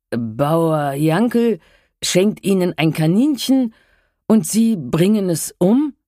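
appalled disbelief. A narrator's reading. Herr Paulsen to Frau Helbing, slow appalled disbelief. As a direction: spell out each word in horror.

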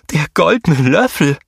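little hero calling out in excitement; excited call. A narrator's reading. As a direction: shout out the find. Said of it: "rief Nigor".

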